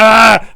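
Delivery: loud, high exasperated shout; frustrated yell. Real, not acted. Streamer catching himself ranting, yelling in frustration. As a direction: blurt out a cry of frustration at yourself. Frustrated outburst mid-rant.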